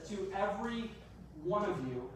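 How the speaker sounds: high "every", pause, falling "one of you"; stress on every. Shown as a demonstration. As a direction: raise "every" and let it hang before finishing. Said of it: "there is an emphasis".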